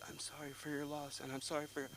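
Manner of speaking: quiet, polite mourner's voice; hushed condolences. Retold or imitated. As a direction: offer sympathy under your breath at a funeral. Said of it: "and it's very quiet".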